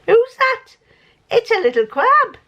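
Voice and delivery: high, delighted cooing at the fabric; squealing with delight. Real, not acted. Showing fabric print to viewers.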